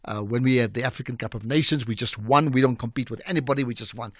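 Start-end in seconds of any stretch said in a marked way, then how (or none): 3.6-4.1 a quieter, dry repeat; deadpan throwaway echo; dry aside. Shown as a demonstration. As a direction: drop the voice to say it again. Repeats the point dryly.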